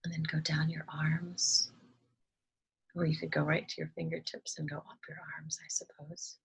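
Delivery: calm, soft meditation voice with long pauses; unhurried, hushed instructions, leaving space to breathe; guided meditation. Acted. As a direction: gently guide listeners through relaxing each body part.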